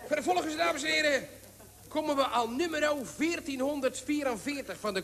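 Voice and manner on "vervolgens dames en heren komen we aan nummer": loud high announcement; auctioneer. Acted. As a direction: call the room to order. Auctioneer announces next lot.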